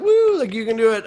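encouraging woo from the room; cheering crowd. Retold or imitated. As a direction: cheer him on like a kind audience. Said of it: "they kinda were like".

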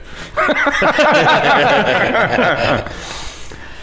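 long high-pitched cackle; high long laugh. Real, not acted. Laughing at Madea joke.

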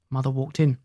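ordinary delivery, nothing marked

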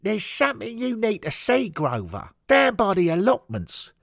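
high, loud summons; urgent hooligan shout. Acted. Head steward summons Henry down by the allotments, urgent shout. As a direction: holler it like a rough steward.

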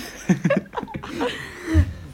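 long high laugh. Real, not acted. Host laughing at the baby brother joke. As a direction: let the laugh run.

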